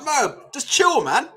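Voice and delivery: slow soothing coach calming a fighter. Retold or imitated. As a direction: ease them down, slowly. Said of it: "I'm like".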